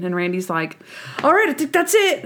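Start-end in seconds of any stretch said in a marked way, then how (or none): 1.2-2.2 higher, hurried wrap-up voice; impatient Randy. Retold or imitated. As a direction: play Randy itching to leave. Voicing Randy wrapping up.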